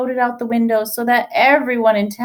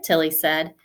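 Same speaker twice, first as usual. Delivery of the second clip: ordinary delivery, nothing marked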